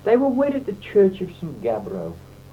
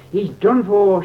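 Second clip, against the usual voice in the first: loud, higher alarmed cry; shouted by the crowd; 5 semitones higher, 7 dB louder, slower than usual. A narrator's reading. Crowd below yells as John slides down, loud alarmed cry. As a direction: yell it like a horrified crowd. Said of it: "shouted several score voices".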